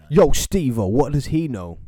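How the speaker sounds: loud, hearty shout for a mate; calling out. Real, not acted. Calling Steve-O to ask what he knows, loud call-out. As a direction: holler it as if across the room.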